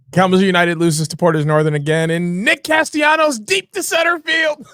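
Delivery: fast, rising into a high shout; excited announcer shout. Pretend. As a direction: yell the call, mocking. Mocking version of the call.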